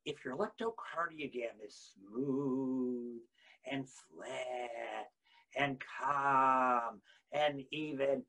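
soft, slow, even voice; flat calm. Acted. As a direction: let it go flat and still. Describing flat line.

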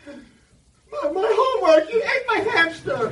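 very high, loud laughing cry; laughing kid mix-up. Retold or imitated. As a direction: wail the mixed-up excuse like a flustered kid. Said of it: "I said".

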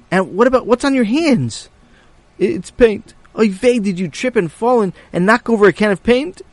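high, loud fretting father; high worried voice. Acted. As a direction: fuss over him, high-pitched. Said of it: "Tati said".

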